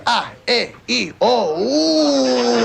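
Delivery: short "Ah, eh, e, o", then a high held "ooh"; Italian vowels. Shown as a demonstration. Teaching all the Italian vowels, short sounds then long high "ooh". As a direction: chant each vowel, stretching "ooh" high. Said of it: "Teach all the Italian vowels".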